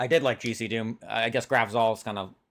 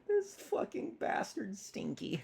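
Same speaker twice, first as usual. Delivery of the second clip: quietly imitating the New York drawl, slowed; hushed, slow mock northeastern accent; soft echo of the accent; 6 semitones higher, 11 dB quieter, slower than usual. Cohost quietly echoes his New York accent. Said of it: "I love when the New York, Boston".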